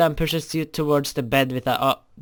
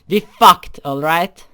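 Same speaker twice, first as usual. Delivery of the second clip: blurted confession; 7 dB louder, slower than usual. Acted. Creeper admitting to zombie, a loud defiant blurt.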